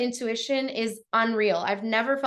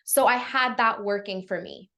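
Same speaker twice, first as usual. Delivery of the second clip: normal talking voice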